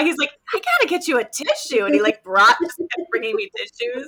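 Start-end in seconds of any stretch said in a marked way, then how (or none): 0.5-1.8 put-on high-pitched little-kid voice; imitating a child. Parent retelling child fetching tissues, high kid voice. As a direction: do your helpful kid, pitched up.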